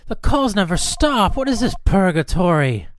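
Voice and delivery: loud, high cry of exasperation; exasperated outburst. Acted. Host worn down by endless calls, exasperated. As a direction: wail it out, utterly fed up.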